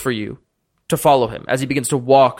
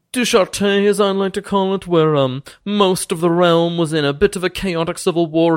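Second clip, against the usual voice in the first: higher, pompous noble voice, suddenly gracious; boastful lord Thaddeus; 5 semitones higher than usual. Acted. Lord welcomes the party after the deception, pompous and higher. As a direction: play a smug ancient lord welcoming guests. Thaddeus recounts his exploits.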